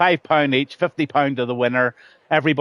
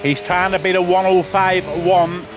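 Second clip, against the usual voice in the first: live race commentary; 5 semitones higher than usual. Real, not acted.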